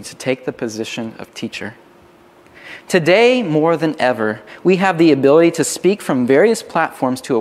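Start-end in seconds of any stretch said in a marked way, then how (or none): none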